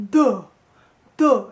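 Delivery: thick, loud dumb duh; dopey duh. Shown as a demonstration. Coach modelling the dopey vowel, thick loud duh. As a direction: drop the larynx and say duh. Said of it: "so repeat after me".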